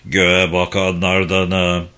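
low, slow put-on voice; a son faking a deep stranger's voice; disguised voice. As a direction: disguise your voice, deep and drawn out. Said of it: "Mahir sesini değiştirerek yanıt verdi".

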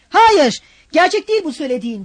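loud, high impatient retort. A narrator's reading. Razumihin retorting to his stubborn friend, impatient. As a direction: cut him off impatiently. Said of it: "dedi Razumihin sabırsızlıkla".

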